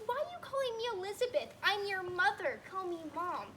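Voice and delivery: high, loud indignant mother voice; indignant mom. Retold or imitated. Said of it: "She said".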